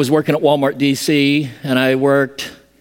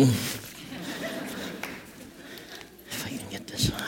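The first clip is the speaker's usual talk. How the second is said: strained whisper while pulling on a harness; straining with effort, breath held; grunting; 17 dB quieter than usual. Real, not acted. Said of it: "Don't mind the grunting".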